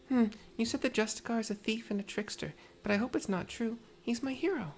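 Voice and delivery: put-on high, small child's voice; little girl voice. Little girl thanking Tepeke, high child voice. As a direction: sound like a grateful little girl.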